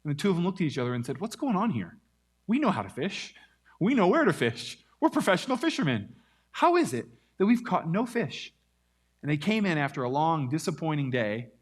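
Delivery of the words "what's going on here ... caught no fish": exasperated fishermen. Retold or imitated. Fishermen voiced in retold story.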